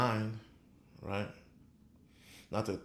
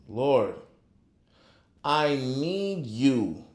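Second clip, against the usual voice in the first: slow, drawn-out plea to the Lord; earnest, slow, louder prayer; pleading prayer; 3 dB louder, much slower than usual. Shown as a demonstration. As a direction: pray it like you mean every word.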